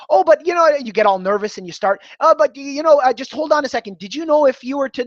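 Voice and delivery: high, hurried, flustered salesman; nervous pleading. Shown as a demonstration. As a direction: scramble to keep them talking. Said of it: "not being assertive is".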